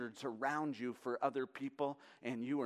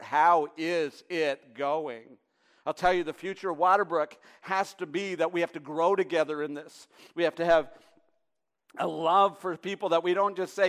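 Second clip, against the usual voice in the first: louder, higher, building sermon cry; full-force preaching; 7 semitones higher, 14 dB louder than usual. Acted. Pastor challenging the congregation about real discipleship, preached at full force. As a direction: press it on the church with rising urgency.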